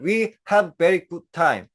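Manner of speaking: slow, spaced "we have very good time"; teacher modelling slowly; very slow demo. Shown as a demonstration. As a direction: read it word by word for the class. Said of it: "예를 들어".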